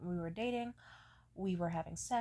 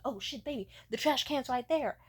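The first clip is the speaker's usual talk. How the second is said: a bit louder, hurried pointing out; urgent boyfriend; 4 semitones higher, 5 dB louder than usual. Retold or imitated. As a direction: tell her quick where the bin is. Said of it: "He was like".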